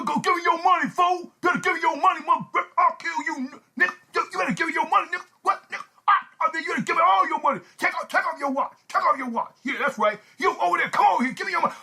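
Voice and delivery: high-pitched, agitated stick-up man; hammed-up armed robber; store robber. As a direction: demand the cash, stammering and urgent.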